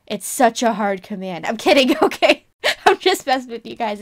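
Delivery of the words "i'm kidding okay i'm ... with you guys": laughing through the words. Real, not acted. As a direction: laugh it off after a prank. Teasing reveal after a fake-out.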